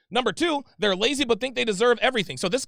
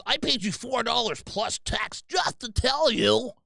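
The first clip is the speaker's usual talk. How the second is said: mocking pretentious guy; 2 semitones higher, slower than usual. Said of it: "That guy was so fucking pretentious".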